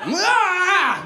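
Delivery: high-pitched mock "aah"; strained cry. Diner straining to see in the dark, mock strained cry. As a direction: let out a high, comic cry. Acting failed attempt to see.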